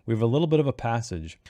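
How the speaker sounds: usual speaking voice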